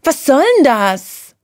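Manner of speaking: high, slow squawking little creature. A narrator's reading. Grumpy Mariechen, dragged from sleep, squawking high. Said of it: "quäkte Mariechen".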